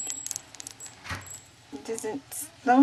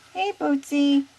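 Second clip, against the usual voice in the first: higher, louder call to the parrot; loud coaxing call; 5 semitones higher, 9 dB louder, slower than usual. Shown as a demonstration. Owner calling her parrot to repeat, higher and louder. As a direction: call it out for the bird to copy. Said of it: "calling to the bird".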